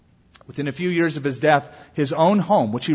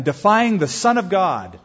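usual speaking voice

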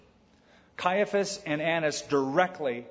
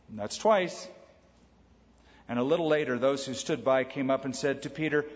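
ordinary talk, nothing marked

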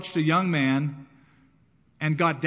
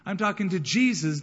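loud, high, defiant outburst; defiant retort; 5 semitones higher, 5 dB louder than usual. Retold or imitated. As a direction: push back hard at the crowd. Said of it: "he probably thought".

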